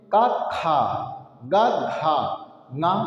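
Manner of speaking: का short, खा with a puff of air; teacher's demo of aspiration; breath contrast. Shown as a demonstration. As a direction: alternate light and aspirated letters. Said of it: "देखो अंतर हुआ कि नहीं".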